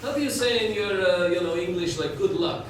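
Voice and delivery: imitated Russian accent, hesitant; mock Russian-accented question. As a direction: mimic a Russian man searching for English words. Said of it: "Russian guy".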